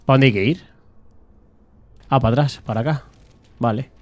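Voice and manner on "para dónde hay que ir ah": normal talking voice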